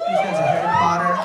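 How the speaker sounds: loud excited shout-out; shouted question. Real, not acted. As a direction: yell it out to the whole room. Calling out to the audience.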